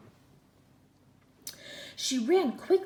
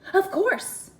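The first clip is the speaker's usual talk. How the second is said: much higher, loud quick promise; eager promise; 12 semitones higher, 12 dB louder than usual. A narrator's reading. Princess promising the frog, high and loud. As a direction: blurt the promise out brightly. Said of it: "the princess promised".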